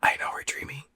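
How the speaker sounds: soft, secretive whisper. Retold or imitated. Dreamer confiding in her friend Misty, whispered. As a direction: lean in and whisper it. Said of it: "leans over to her and whispers".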